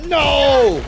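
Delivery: high yelled no; loud. Real, not acted. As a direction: cry out in dismay. Getting spotted, cries out.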